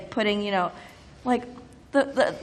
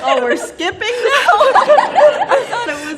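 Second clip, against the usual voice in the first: laughing; 12 semitones higher, 11 dB louder, faster than usual. Real, not acted. Laughing surprise.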